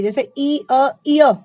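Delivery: ई and अ said slowly in turn, then इय said loudly so the य sound comes out; sounding out the blend. Shown as a demonstration. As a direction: say ई, अ and glide them into a clear इय. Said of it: "आप बोल के देखेंगे ना". (ई और अ को जोड़कर ज़ोर से इय बोलना)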